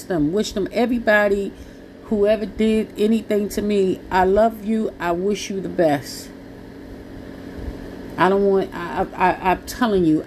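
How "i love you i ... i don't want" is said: ordinary talk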